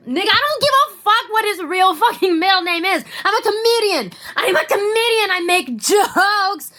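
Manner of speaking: half-laughing, half-crying rant; exasperated outburst. Real, not acted. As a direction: blow up in mock despair at nitpickers. Exploding at nitpickers.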